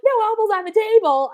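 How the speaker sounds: high-pitched mock telling-off; put-on high motherly reproach; imitated scolding mom. Voices mom scolding Haley.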